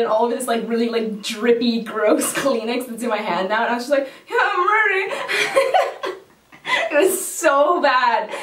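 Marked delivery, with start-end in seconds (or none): 4.3-8.3 higher, giggly numb-mouthed reply; laughing. Real, not acted. Answering the injector while drooling, laughing. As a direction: fake composure, cracking up.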